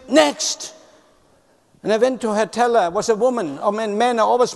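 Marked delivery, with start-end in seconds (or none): 0.1-0.7 high, rising shout of a call; retold scream. Teller screaming at the queue, a mock high yell. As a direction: call out sharply, a bit mock. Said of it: "She screamed".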